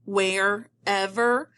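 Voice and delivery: WHEREVER split slowly into "where" and "ever"; syllable by syllable. Shown as a demonstration. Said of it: "I feel those syllables break".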